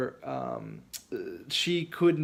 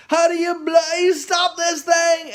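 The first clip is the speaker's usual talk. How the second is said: put-on high panicked English accent shout, louder; a British kid yelling in a panic; 13 semitones higher, 8 dB louder than usual. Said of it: "English accent".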